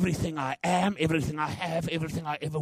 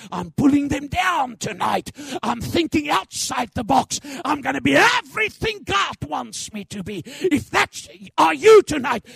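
rising to a shouted declaration; roaring rallying speech; 11 semitones higher, 8 dB louder than usual. Acted.

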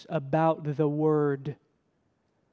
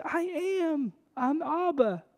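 gentle reassurance; 9 semitones higher, 4 dB quieter than usual. Acted. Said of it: "He says".

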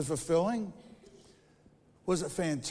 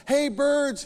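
calling to the birds, higher and louder; 8 semitones higher, 9 dB louder than usual. Acted. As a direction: call out across the yard. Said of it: "he calls".

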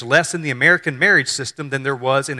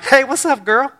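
casual angel; 10 semitones higher than usual. Retold or imitated. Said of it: "the angel's like".

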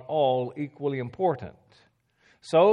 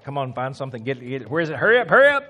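acted hurry; 7 dB louder, faster than usual. Teacher mimicking himself in a hurry, acted impatience. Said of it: "if I'm in a hurry, it's like".

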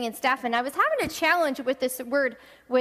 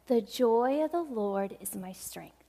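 quiet wise answer; 3 semitones lower, 5 dB quieter than usual. Retold or imitated.

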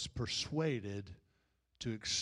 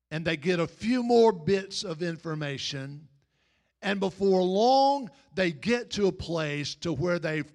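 loud, higher-pitched sermon delivery; forceful preaching; 5 semitones higher, 9 dB louder than usual. Real, not acted. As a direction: preach it out, urging self-examination.

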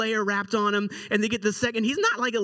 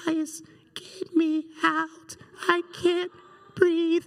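high, thin, slow plea; strained little cry for help, broken by pauses; faint trapped voice; 10 semitones higher, much slower than usual. Retold or imitated. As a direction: imitate a boy squashed inside a couch. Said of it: "we hear the faintest voice from within".

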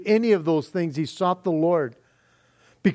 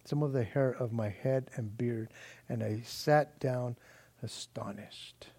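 hushed scripture reading; 5 semitones lower, 9 dB quieter than usual. Acted. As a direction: let Ezra's lament sink to a hush. Preacher reads Ezra's lament quietly.

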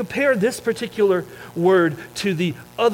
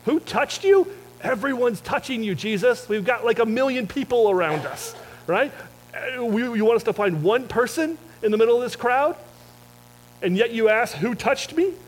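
much higher, exasperated disbelief; incredulous disciples; 7 semitones higher than usual. Pretend. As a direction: act out the disciples' baffled protest at Jesus. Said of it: "this is probably what I would say".

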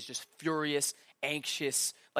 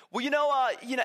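stalling student; 6 semitones higher, 4 dB louder, faster than usual. Retold or imitated. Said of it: "And you're like".

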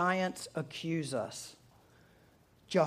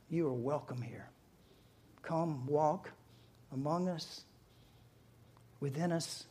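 hushed prayer fading softer and lower; soft, reverent invitation to the Holy Spirit; 3 semitones lower, 5 dB quieter than usual. Real, not acted. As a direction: pray gently, with unhurried pauses. Leads the congregation in prayer.

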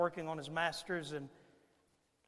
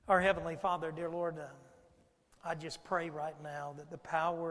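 earnest pastoral prayer, swelling louder at the end; 4 dB louder than usual. Acted. Pastor praying over the congregation, rising to a loud plea. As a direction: pray over the room, building to a plea.

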